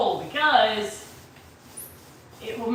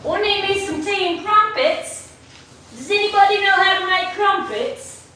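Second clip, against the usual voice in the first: higher-pitched playful British voice; British accent; 5 semitones higher than usual. Pretend. Asking viewers for tea and crumpets, mock British accent. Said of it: "You missed me being British yesterday".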